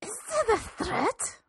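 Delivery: put-on high, quiet, aghast old lady voice; shocked old woman. Old woman to the reverend, aghast at his threat. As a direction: gasp it out like a scandalised pensioner.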